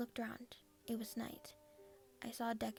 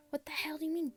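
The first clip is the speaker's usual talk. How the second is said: higher, louder angry outburst; angry shout; 5 semitones higher, 4 dB louder than usual. Acted. Bakugo yelling at the girl who called him cute, angry shout. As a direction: snap back offended. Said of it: "Bakugo shouted at me".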